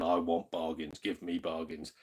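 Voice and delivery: low, mock-stern demand for bargains; a hint of a stern collector barking orders; mock serious bargain hunter. Streamer mimics himself at a games fair, low and mock-stern. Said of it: "goes there all serious".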